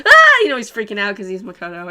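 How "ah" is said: short high shriek of fright, much louder; mock scream. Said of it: "[name] was like".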